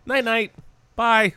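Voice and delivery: high teasing bedtime goodbye; sing-song goodnight. Retold or imitated. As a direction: say it like tucking in kids. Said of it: "That's what I try to do with my kids".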